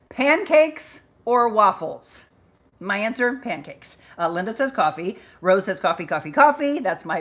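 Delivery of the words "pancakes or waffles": drawn-out game host announcement. Acted.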